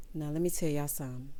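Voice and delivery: normal talking voice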